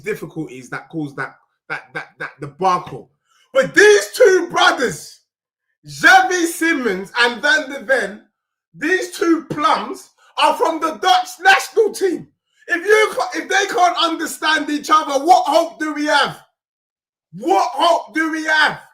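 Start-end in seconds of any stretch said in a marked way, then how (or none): 3.5-15.2 shouting. Real, not acted. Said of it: "I keep shouting". 15.2-16.4 loud exasperated shout. Real, not acted. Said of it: "I keep shouting". 17.3-18.8 slower, drawn out shouted repeat. Real, not acted. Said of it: "I keep shouting".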